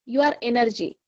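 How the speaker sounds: ordinary talk, nothing marked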